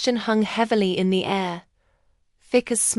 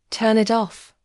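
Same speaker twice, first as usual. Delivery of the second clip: ordinary delivery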